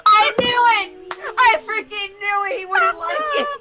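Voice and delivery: shouting in gleeful triumph; triumphant shout. Real, not acted. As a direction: yell it, high and loud. Called the friend's reaction.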